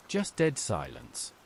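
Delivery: plain reading voice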